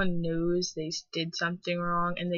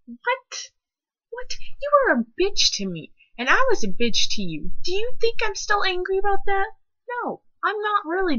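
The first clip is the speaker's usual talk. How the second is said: high, loud indignant rant; ranting at a former bully; worked-up rant; 12 semitones higher, 5 dB louder than usual. Real, not acted. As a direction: build the outrage up. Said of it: "angry rant".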